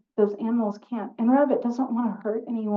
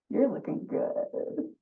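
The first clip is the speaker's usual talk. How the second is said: fox whispering; 3 semitones higher, 4 dB quieter, slower than usual. Acted. Fox flatters rabbit.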